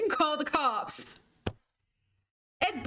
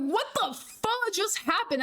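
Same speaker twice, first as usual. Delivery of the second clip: higher, slower, disbelieving; incredulous outburst; 6 semitones higher, slower than usual. Retold or imitated. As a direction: throw the question back at them, baffled. Retelling shock to managers.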